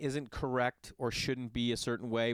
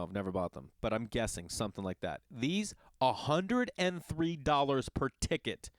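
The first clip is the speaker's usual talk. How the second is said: ordinary talk, nothing marked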